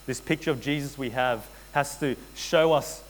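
ordinary delivery

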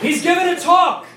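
high, loud relay to another room; yelling to spouse. Retold or imitated. Husband on the phone yelling back to his wife in the kitchen, high shout. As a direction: holler it away from the phone.

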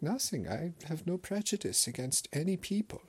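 high, hammed-up German accent with a flat denial; mock German grandpa. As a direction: deny it all in the grandpa voice. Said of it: "And he's like".

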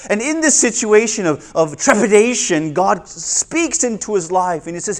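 ordinary delivery

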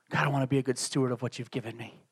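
soft, plain, earnest words to God; simple quiet prayer. Shown as a demonstration. Pastor showing the congregation how he prays, soft. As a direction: pray it low and sincere. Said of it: "This is prayer".

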